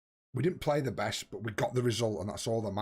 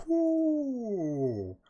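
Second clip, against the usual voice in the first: surprised ooh; 10 semitones higher than usual. Real, not acted. Reacts to the Liverpool draw.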